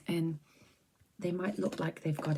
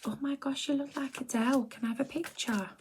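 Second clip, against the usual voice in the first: much higher gushing fan voice; excited fan; 9 semitones higher than usual. Retold or imitated. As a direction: squeal it like a starstruck stranger. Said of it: "people go".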